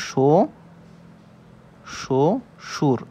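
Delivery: "শো ... সুর" split by a clear pause; syllable by syllable. Shown as a demonstration. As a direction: sound out শো, then সুর, as separate syllables. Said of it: "এভাবে লিখতে পারি". ("শো ... সুর" থেমে থেমে ভেঙে উচ্চারণ)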